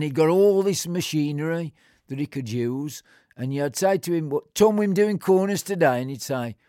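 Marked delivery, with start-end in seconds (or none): 4.3-6.0 calling across the pitch. Retold or imitated.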